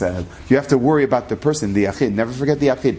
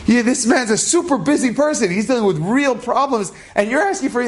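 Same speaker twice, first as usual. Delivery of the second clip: higher, scolding father's voice; stern father; 10 semitones higher than usual. Retold or imitated. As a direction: scold the boy like an upset dad. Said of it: "father scolding".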